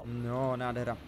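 ordinary talk, nothing marked

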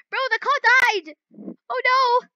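high, loud, alarmed outcry; panicked outcry. Real, not acted. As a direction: cry out as the car breaks down. Car dies in game.

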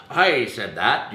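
loud, drawn-out boast; mock credit-claiming. Colleague claiming credit among creatives, slow mock boast. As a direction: stretch it slowly, claiming all the glory. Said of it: "nobody has to say".

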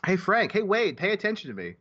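high, attention-seeking call; pestering fan. Acted. Fan hailing Frank at Gen Con, high mock pestering.

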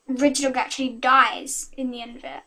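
ordinary talk, nothing marked

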